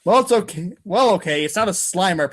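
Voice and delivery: ordinary talk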